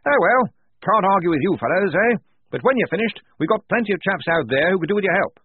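high, loud hearty officer voice; blustering major. Acted. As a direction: boom out like a jolly old officer.

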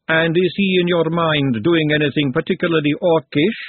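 lightly voiced inquiring lady; composed lady, probing. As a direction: probe delicately, phrase by phrase. Woman questions Glenda.

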